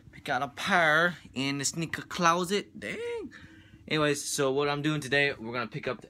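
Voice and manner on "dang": sudden high-pitched dang; surprised dang. Real, not acted. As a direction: yelp it out in surprise. Surprised reaction at sneaker closet.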